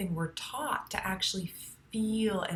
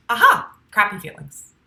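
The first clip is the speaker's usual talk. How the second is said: loud burst of recognition; waking-up aha; 3 semitones higher, 15 dB louder than usual. Shown as a demonstration. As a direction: demonstrate a sudden "aha". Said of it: "the sound that it makes is aha".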